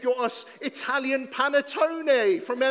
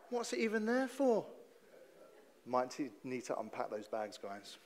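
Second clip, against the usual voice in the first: normal talking voice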